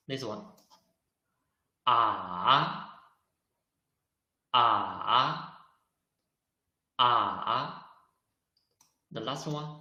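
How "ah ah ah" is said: "Ah" dipping low, then rising; third tone. Shown as a demonstration. Teacher showing learners four tones, "Ah" dipping in third tone. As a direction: let "Ah" sink and swing back up. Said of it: "show you how to read four tones".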